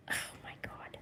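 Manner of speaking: whispered under her breath; exasperated whisper. Real, not acted. Streamer whispering as the cat climbs in the wrong pouch. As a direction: hush it in disbelief at the cats.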